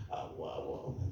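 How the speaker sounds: soft, voiceless stammer imitating speechlessness; struck speechless. Preacher retells being unable to answer the guide, mock speechless stammer. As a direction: act out trying and failing to speak. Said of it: "nggak bisa ngomong".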